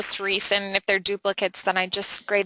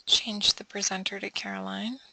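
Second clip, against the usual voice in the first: whispering to herself; 8 dB quieter than usual. Real, not acted. Muttering to herself at the computer.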